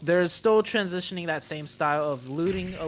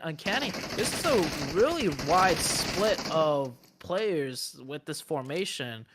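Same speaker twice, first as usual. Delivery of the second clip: normal talking voice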